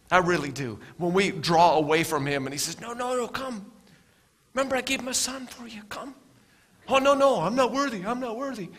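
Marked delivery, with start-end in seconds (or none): 2.8-6.1 soft, higher pleading voice of God; a lightly voiced tender Father calling. God pleading in preacher's story. 6.9-8.7 higher, whiny protest; a lightly voiced ashamed sinner; unworthy sinner. Sinner's reply acted out.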